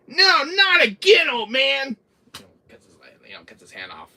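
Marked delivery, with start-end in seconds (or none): 0.1-2.0 put-on high, loud, slow bellow at the old man; shrieking mock villain yell; Jack's yell. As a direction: scream it out as the crazed father. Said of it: "he's like".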